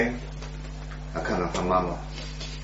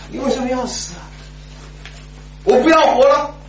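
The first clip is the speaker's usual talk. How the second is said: crying refusal; 13 semitones higher than usual. Retold or imitated. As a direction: wail like a grieving child. Boy refusing food, crying.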